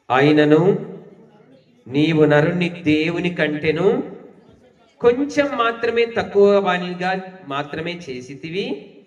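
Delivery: loud, slow, measured Bible verse reading; solemn public reading of a psalm; scripture reading. Acted. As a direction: read the verse out strongly, stretching the line endings. Reads a scripture verse aloud.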